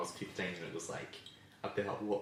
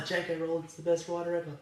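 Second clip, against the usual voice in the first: mocking; 8 semitones higher than usual. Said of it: "they're like".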